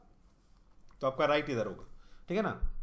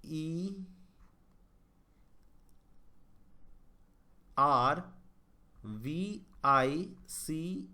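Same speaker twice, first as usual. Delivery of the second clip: letters spelt one at a time, slowly; slow spelling; much slower than usual. Shown as a demonstration. Teacher spells service slowly to test the answer. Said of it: "देखते हैं".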